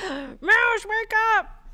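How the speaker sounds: high gasp, then call out; gasping wake-up call. Pretend. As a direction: startle them awake playfully. Chatter falling asleep while drawing.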